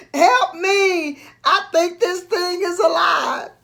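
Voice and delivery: high, loud cry for help; panicked shout. Acted. Tangled in rope, panicking.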